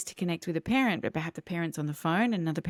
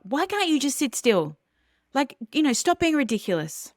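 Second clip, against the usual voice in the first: higher, louder mock yell at a child; imitated yelling parent; 7 semitones higher, 4 dB louder than usual. Parent at the dinner table yelling at a child who won't sit still, mock shout. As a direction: play the exasperated parent shouting across the table. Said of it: "start yelling at that child".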